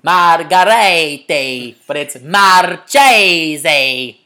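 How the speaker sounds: slow, loud mock Italian accent; over-the-top fake Italian accent, stretched vowels; Inglourious Basterds Italian. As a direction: drag out Marchese in a hammy Italian accent. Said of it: "I'm the guy in, uh, shit, Inglourious Bastards".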